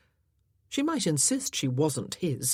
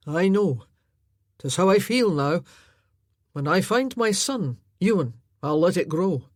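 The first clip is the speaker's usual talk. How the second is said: louder, resigned man's voice; grieving foster brother; 6 dB louder than usual. Acted. Foster brother explaining his shaved head, grave.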